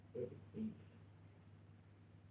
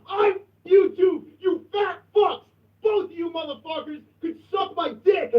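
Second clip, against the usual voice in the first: furious rant; 4 dB louder than usual. Real, not acted. Host raging at rival streamers, loud furious yelling.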